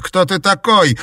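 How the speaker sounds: high, loud frantic yell. Acted. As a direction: yell at the captor. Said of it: "Не ори". (крик)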